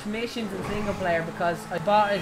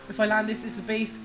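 ordinary delivery

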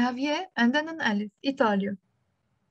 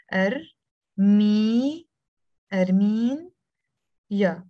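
Armenia built up in pieces with pauses: "Ar, mi, Armeen, ya"; teacher spelling the word by sound; syllable by syllable; 4 dB louder, much slower than usual. Shown as a demonstration. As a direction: sound out each syllable separately, stretching "mi" and "Armeen".

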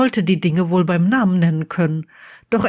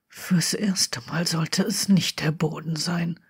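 leise, tonlos geflüstert; fast nur gehaucht; gemurmelt; 7 dB quieter than usual. A narrator's reading. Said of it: "murmelte Draco". (Draco raunt Harry zu, tonlos und leise)

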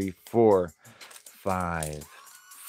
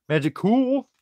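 "Magical" sung out much higher and louder; squeaky high magical; 12 semitones higher, 4 dB louder than usual. Shown as a demonstration. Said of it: "They're magical".

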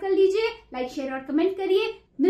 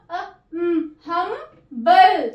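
Humble split slowly as हम-बल; slow sounding out; 5 dB louder, much slower than usual. Shown as a demonstration. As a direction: stretch humble syllable by syllable. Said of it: "अपनी short sound देगा". (Humble को धीरे धीरे टुकड़ों में बोलिए)

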